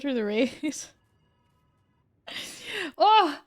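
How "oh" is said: sudden high yelp of surprise; jolted awake by the alert; startled oh. Real, not acted. Streamer startled by a loud raid alert, yelps.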